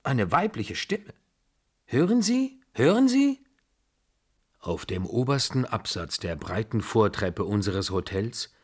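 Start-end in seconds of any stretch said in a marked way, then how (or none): 1.9-3.4 high, excited double question; urgent questioning. Acted. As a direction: voice leaps way up, urging someone to listen. Startled, hearing his name called.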